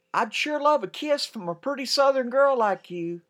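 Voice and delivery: high, loud flirty soldier call; loud teasing catcall. Acted. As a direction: holler it like a cocky GI from a truck. Soldier calling out from truck.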